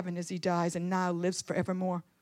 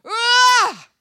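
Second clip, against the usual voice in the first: sudden high, loud "Ah"; loud pained cry; 13 semitones higher, 11 dB louder than usual. Acted. Preacher acts out Stephen under the stones, loud high cry.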